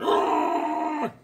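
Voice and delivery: high, strained ghoul screech; drawn-out monster shriek; zombie cry. Acted. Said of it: "there's another one behind him going".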